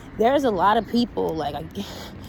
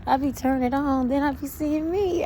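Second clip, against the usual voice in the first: higher, playful Wendy impression; imitating Wendy; 6 semitones higher than usual. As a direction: do Wendy, tickled with herself. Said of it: "She was like".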